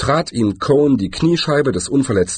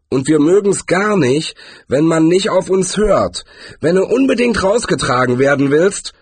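louder, higher bullying threat; growled threat; 8 semitones higher, 4 dB louder than usual. A narrator's reading. Gang leader threatening the dwarf, loud growl. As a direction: press the threat hard, voice raised. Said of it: "knurrte er".

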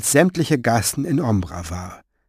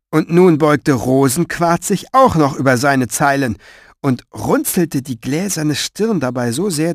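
ordinary delivery

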